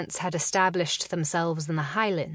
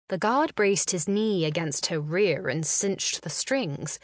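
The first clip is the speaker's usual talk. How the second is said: plain reading voice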